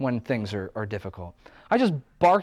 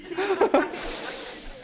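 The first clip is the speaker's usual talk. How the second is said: a high, bright burst of laughter; giggling high. Real, not acted. Laughing at own bliss bit.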